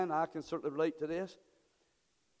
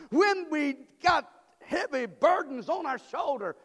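full-force preaching; 6 semitones higher, 7 dB louder than usual. Acted.

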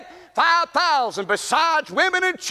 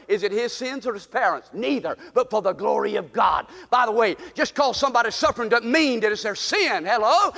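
ordinary talk, nothing marked

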